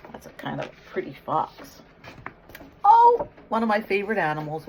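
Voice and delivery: usual speaking voice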